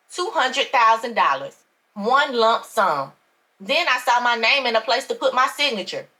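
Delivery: plain reading voice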